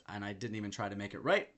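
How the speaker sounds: ordinary talk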